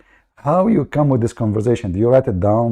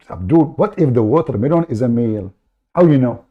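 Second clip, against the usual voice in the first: normal talking voice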